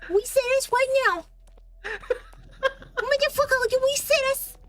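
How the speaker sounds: high, urgent, whiny begging; high pleading. Real, not acted. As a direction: plead frantically for a reset. Begging partner to reset.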